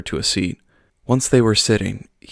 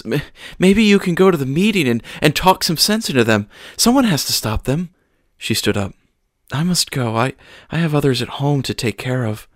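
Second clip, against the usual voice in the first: high, stammering urgent plea; anxious pleading; 6 semitones higher, 3 dB louder than usual. Acted. Mother urges the pastor to go to the meeting, anxious plea. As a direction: push the request through nerves. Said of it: "She started sobbing again".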